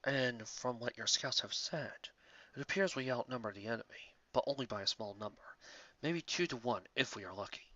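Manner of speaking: a calm antelope chief, quiet and unhurried. A narrator's reading. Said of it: "Lang Lang Horing replied".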